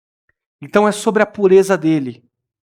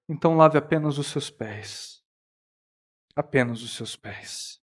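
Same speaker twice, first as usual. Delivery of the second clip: soft appeal; 7 semitones lower, 9 dB quieter than usual. Acted.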